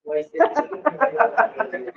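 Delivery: soft, breathy chuckle; a light laugh to oneself. Real, not acted. Teacher jokes about the enlarged syllabus, soft amused laugh.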